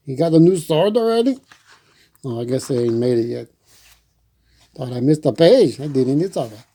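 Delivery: plain reading voice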